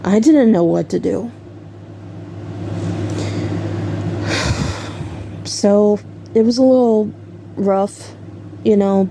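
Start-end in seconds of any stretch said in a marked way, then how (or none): 2.2-5.4 long soft exhale; real sigh before going on. Real, not acted. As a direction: let out a long, quiet sigh. Sighs while recalling hard episode.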